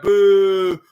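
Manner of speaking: long, loud "boo" held on one note; imitated crowd boo. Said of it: "fans are cheering, like".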